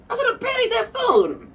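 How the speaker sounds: high squeaky mock scold. Repeats line in squeaky voice.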